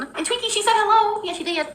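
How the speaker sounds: high, sing-song cooing; cooing pet talk. Real, not acted. Passing a hello on to Twinkie, high-pitched cooing. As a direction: coo it in a little high voice.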